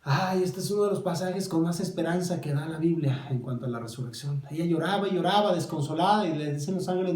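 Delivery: ordinary talk, nothing marked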